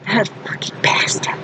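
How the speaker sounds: a whispered curse under the breath; muttered curse. Retold or imitated. Whispering a grudge while forced to write an apology. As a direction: hiss it to yourself, bitter.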